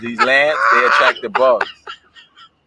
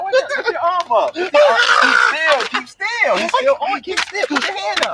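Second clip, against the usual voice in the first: high, urgent panicked instructions. Real, not acted. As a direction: squeal the orders, stay frantic. Guiding friend away from bug.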